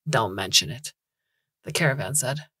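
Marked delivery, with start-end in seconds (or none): 0.1-0.9 low, rough-voiced ancient hobo; old hobo. A narrator's reading. Caravan answers Bloom's thanks, old hobo voice. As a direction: drop low like a weary old tramp. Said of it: "voice of an old hobo, the sort of rough-voiced, ancient".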